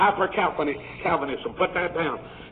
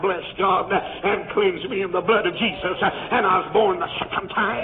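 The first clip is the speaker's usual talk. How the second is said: ordinary talk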